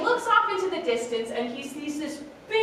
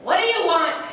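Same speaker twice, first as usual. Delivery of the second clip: lofty, loud, a little raised; haughty; 5 semitones higher, 7 dB louder than usual. A narrator's reading. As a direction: look down your beak at her. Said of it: "there is definite haughtiness in his voice".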